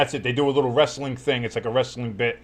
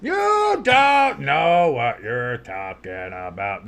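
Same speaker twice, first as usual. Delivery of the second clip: very slow drawn-out words; slow mocking echo; 3 dB louder, much slower than usual. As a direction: drag each word out to mock. Repeated mockingly slowed.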